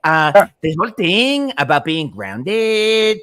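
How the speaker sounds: high, slow mock Spanish-Valley-girl accent. Mocking the wife on morning TV, exaggerated Spanish Valley girl accent. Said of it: "like a Spanish Valley girl combo".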